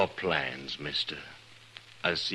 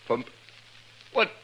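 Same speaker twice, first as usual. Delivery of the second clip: shocked protest; 12 semitones higher than usual. Acted. Adams shocked at murder charge.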